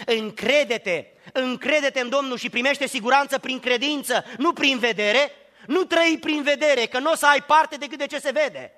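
loud, high, rising sermon climax; preaching at full force. Acted. Preacher to congregation, loud full-force preaching. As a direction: preach it out, building the list.

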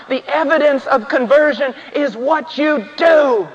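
emphatic, high-pitched sermon delivery; full-force preaching. Real, not acted. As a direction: drive each point home to the congregation, pitched high.

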